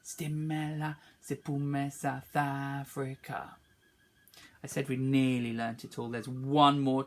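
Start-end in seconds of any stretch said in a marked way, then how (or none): 0.0-3.6 soft echo of the pupils; quiet pupil response; softly. Shown as a demonstration. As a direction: say it quietly as their turn. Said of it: "Your go".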